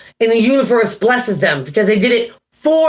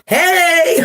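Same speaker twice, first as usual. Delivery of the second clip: high, drawn-out mock hello; acted excited greeting; 7 semitones higher than usual. Host imitating new viewers greeting everyone, high and excited. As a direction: imitate newcomers calling out a high hey. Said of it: "the new people are like".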